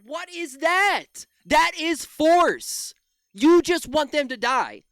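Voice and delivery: loud, high, slow disbelief; outraged. Real, not acted. Streamer reacting to pundit's call for force, loud outrage. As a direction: hit every word in disbelief.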